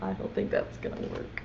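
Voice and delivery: normal talking voice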